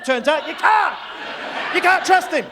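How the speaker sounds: loud, laughing outrage; shouting punchline. Real, not acted. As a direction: yell it out, cracking up. Punchline yelled at the crowd.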